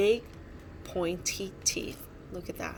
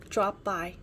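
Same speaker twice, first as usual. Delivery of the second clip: plain reading voice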